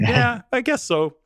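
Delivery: high, drawn-out, slow concession; imitated elderly mom conceding. Mom conceding to her child, imitated high and slow. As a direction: stretch it out high as Mom giving in. Voices mother conceding reluctantly.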